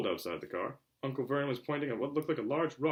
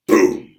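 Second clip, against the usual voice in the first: boom; 8 semitones lower, 20 dB louder than usual. A narrator's reading. Loud knock in story.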